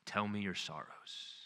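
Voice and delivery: soft, slow, gentle invitation; warm, reassuring. A narrator's reading. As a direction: comfort him as you say it. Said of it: "breathes a warm, reassuring breath".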